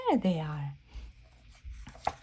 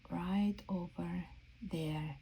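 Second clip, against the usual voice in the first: slow, hushed reveal whisper; quiet slow secret whisper; 4 semitones lower, 9 dB quieter, slower than usual. Acted. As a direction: breathe it out slowly like a secret. Playful reveal of hidden balloon.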